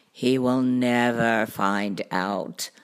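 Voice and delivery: low, slow cartoon cat voice; deep, leisurely storybook cat; unworried cat. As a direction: drawl it out low, unbothered and easy. Cat reassures children.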